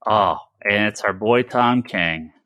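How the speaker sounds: ordinary delivery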